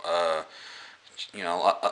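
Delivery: ordinary talk, nothing marked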